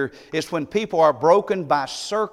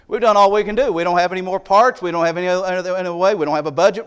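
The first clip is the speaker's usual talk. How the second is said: mock excuse; 4 semitones higher, 6 dB louder, much faster than usual. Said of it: "Fred says this all the time".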